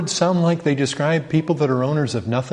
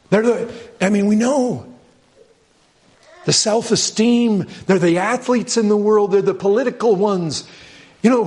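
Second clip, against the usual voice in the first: rising, urgent sermon push, much higher and faster; preaching fervor; 9 semitones higher, 4 dB louder than usual. Acted. Preacher rousing the congregation about worldly heroes, fervent.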